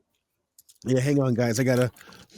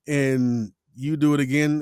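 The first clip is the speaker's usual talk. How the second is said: ordinary talk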